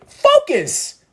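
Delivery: high, ringing call to focus; high command. Acted. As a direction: snap them to attention.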